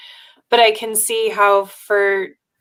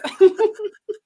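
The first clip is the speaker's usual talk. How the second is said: high laugh; 12 semitones higher than usual. Real, not acted. Laughing at friend's quote.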